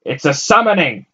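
loud, high insistent repeat. A narrator's reading. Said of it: "the fat man repeated".

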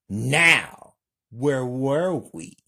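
loud, high menacing call; yelling. Acted. Lena returning to Kelly, loud menacing yell. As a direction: shout it like a gloating villain. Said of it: "Lena yells".

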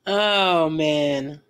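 ordinary talk, nothing marked

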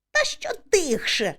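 narrated indignant cry; shouting back. A narrator's reading. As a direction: call back in protest. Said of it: "кричу я".

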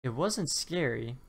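usual speaking voice

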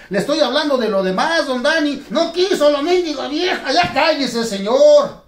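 high, loud heated bickering; loud mock quarrel. Arguing with the dummy, loud high shouting. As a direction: shout him down, pitched high.

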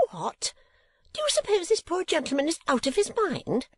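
put-on a scandalised old lady, very high; Mrs Nickleby.

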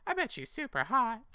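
very high, eager squeak; high squeaky dude. Acted. Friend imagining the girl, squeaky. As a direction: squeak it like a horny teen.

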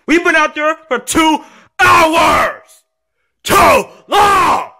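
high, slow, wailing protest; screaming complaint. Acted. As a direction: drag out each word in a tantrum yell. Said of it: "So stop screaming".

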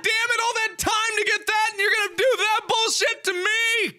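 furious yell. Real, not acted. Game undoes progress, raging.

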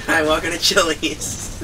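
slow, drawn-out mock restaurant greeting; mock Chili's host greeting. Shown as a demonstration. Showing a friend the Chili's meme, slow hammed-up greeting. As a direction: redo the Chili's meme greeting for a friend who never saw it, stretched out slowly. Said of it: "You, you never seen that?".

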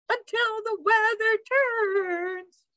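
high, slow sing-song; chirping bird. A narrator's reading. Bird tells dog how long she stays, high chirp. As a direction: trill it high, holding the last word. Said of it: "chirped the bird".

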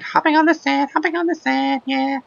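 very high playful chant; high singsong. Pretend. Gamer hopping on sand in very high silly voice. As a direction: chirp it up high like a cartoon.

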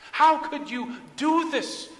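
slow, high, loud accusation; wounded, indignant outcry; angry reproach. Acted. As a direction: drag out each word in hurt disbelief. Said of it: "Mary and Martha were angry with Jesus".

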